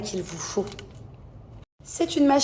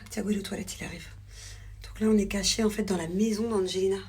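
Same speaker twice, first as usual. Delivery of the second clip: normal talking voice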